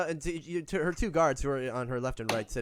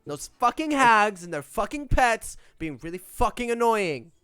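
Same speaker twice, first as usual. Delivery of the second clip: higher, louder, spoiled demanding rant; queen's tantrum; 7 semitones higher, 9 dB louder than usual. Acted. Queen rants to the party about the tower, louder spoiled tantrum. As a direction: stamp your foot like a spoiled royal.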